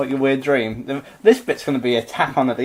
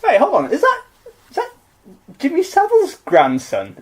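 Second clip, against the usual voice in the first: mock alarm; 12 semitones higher, 4 dB louder than usual. Dark joke aside.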